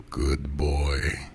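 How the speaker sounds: low, warm gravelly praise; a lightly voiced master, murmuring approval; gravelled warmly. A narrator's reading. Master praising his pet, low and gravelly. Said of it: "he gravelled warmly".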